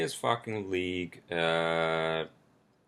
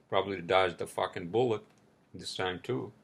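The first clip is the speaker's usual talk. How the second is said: usual speaking voice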